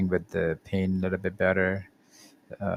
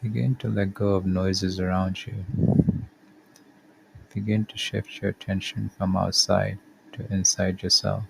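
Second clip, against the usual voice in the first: calm, measured body-scan guidance with long pauses; guided meditation. Acted. Podcast host guiding listeners through a body scan, calm meditation voice. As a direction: lead the listener gently through each step, unhurried.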